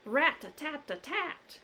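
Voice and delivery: plain reading voice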